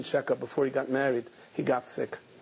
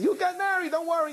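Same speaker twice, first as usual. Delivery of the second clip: reassuring friend. Retold or imitated. Voicing another, pitch up strongly.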